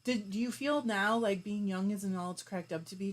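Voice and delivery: usual speaking voice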